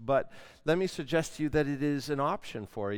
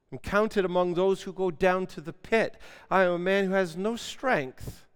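dramatic scripture reading; 8 semitones higher, 10 dB louder than usual. Acted. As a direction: proclaim the psalm's despair, building in force. Reads the psalm with force.

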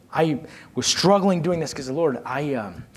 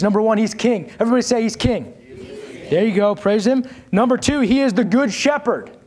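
full-force preaching; 4 semitones higher than usual. Acted. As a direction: lift the congregation, preach it out.

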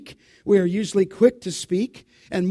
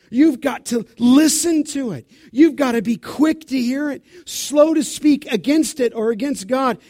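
louder, higher urging; preaching at full force; 4 semitones higher, 5 dB louder than usual. Acted. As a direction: press the church to listen. Building pulpit exhortation.

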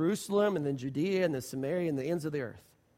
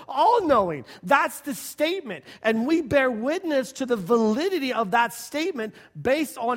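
higher and louder, building fervor; preaching at full force; 5 semitones higher, 4 dB louder than usual. Real, not acted. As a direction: proclaim it to the congregation.

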